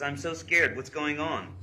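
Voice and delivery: loud, high, urgent read-aloud; imitated alarm. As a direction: read her scared text out with force. Reads girl's scared texts aloud.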